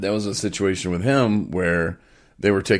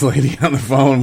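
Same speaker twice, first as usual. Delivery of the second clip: laughing; 8 semitones higher, 8 dB louder than usual. Real, not acted. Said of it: "laughs yelling at this".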